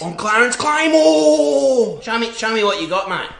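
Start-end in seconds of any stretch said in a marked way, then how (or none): 0.0-2.0 loud, slow and proud, every word landed; said with his chest. Acted. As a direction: boom your name out with full chest. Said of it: "Say it with your fucking chest".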